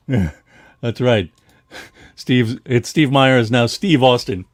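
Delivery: usual speaking voice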